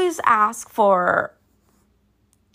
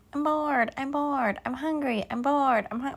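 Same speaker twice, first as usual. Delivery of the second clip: put-on whiny bored kids; 5 semitones higher, 6 dB quieter than usual. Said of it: "all they want is, like".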